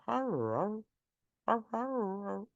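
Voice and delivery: lightly voiced collie har har, rising; collie grumble noise. Showing listeners how collies chat, imitating a collie grumble. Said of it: "like this".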